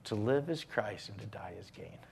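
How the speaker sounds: hushed devotional whisper; reverent whisper. Acted. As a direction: breathe the verse like a secret. Sermon climax dropped to whisper.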